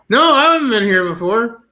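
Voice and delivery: high, emphatic put-on innocence; disguised returning customer. Pretending to be a new gold customer, high mock innocence. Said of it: "I'll start talking in an accent".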